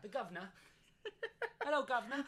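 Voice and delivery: laughing, high mock-British "Hello, governor"; mock British accent. Joking with cohost, a laughing hammed-up British accent. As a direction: ham up a cockney greeting through giggles. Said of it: "they make fun, they do American accents".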